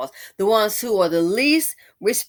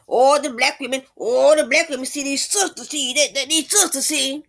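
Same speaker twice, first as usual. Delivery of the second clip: loud, high mock whine; mocking whiny men; 5 semitones higher, 4 dB louder than usual. Mocking the men's complaints.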